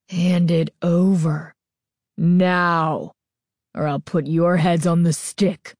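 put-on a masked girl threatening two boys; vicious threat. A narrator's reading. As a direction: threaten them coldly. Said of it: "vicious tone in her voice".

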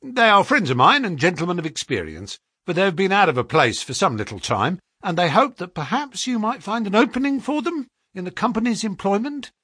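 mildly glib clerk. A narrator's reading. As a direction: rattle off the cover story easily. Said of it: "said our clerk glibly".

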